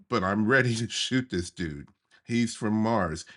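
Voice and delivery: normal talking voice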